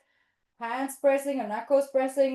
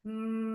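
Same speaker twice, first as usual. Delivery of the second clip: long, even mm on one note; sustained bee hum; closed-lip hum; 4 dB quieter than usual. Shown as a demonstration. Teacher shows lips pressed together, steady hum. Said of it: "pressing your lips together".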